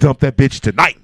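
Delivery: loud punchline. Acted. Warning fellas, loud punchline.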